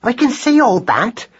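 high, impatient retort; irritated little mouse mother; snapping. A narrator's reading. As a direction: snap it, pitched right up. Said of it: "snapped Madeline".

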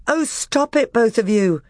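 put-on high, loud young king. A narrator's reading. Caspian answering the dwarf in council, loud and firm. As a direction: stand firm on your word.

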